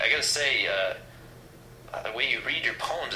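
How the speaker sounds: normal talking voice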